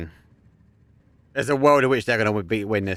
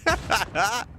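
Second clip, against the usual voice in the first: very high, slow, broken with laughter; very high squeaky laugh-cry; much slower than usual. Real, not acted. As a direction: laugh until it hurts. Caster crying with laughter.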